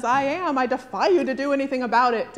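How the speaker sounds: lightly voiced, loud defiant movie hero; defiant superhero Jesus. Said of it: "that figure in the movie that answers".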